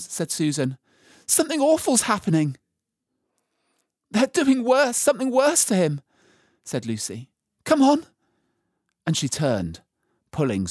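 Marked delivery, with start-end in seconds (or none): 1.3-2.6 high, fast, loud panic; alarmed girl. A narrator's reading. Susan fears what is behind her, alarmed. 4.1-6.0 put-on high, loud, horrified child; distressed girl. A narrator's reading. Lucy fears for the dead Aslan, distressed. 7.6-8.1 high, loud urging; urgent girl. A narrator's reading. Lucy pulls Susan around with her, urgent.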